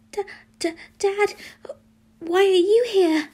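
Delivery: high, startled stammer; stammer, then blurt the question high and loud; stuttering. A narrator's reading. Boy stunned to see his dad, stuttering. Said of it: "he stuttered".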